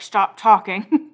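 slow, tight-jawed mock warning; through gritted teeth. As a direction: force it out slowly between clenched teeth. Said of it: "between your teeth, you're like".

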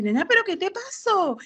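a parent asking her daughter what happened, in a high worried voice; mother's worried question. Retold or imitated.

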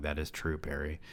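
quiet, flat mocking repeat of "That is true, Perry."; deadpan mockery. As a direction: say "That is true, Perry." softly, as if it were the dumbest line ever. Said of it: "Wow, amazing dialogue".

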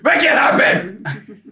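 slow, drawn-out yell. Real, not acted. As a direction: shout it out slowly. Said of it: "Stop yelling".

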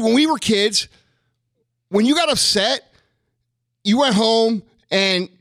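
ordinary delivery

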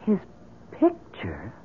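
hushed disbelief; stunned, halting question; taken aback. Acted. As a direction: drop low and quiet on the second word. Client taken aback by photo.

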